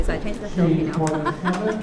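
chuckling as she says it; laughing. Real, not acted. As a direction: say it while laughing at yourself. Amused at her own renaming.

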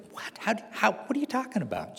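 high, startled what-are-you-talking-about; mock disbelief. Husband hearing his wife say they're stalked, half-mocking surprise. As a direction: play the baffled husband caught off guard. Incredulous husband, retold quote.

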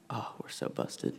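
hushed, whispered aside; whispered dread. Retold or imitated. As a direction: imitate whispering to your partner in crime. Retold aside to brother when caught.

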